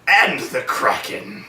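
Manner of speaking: slow, grand tacked-on line; lightly voiced pompous god; mock decree. Completing Clash of the Titans quote.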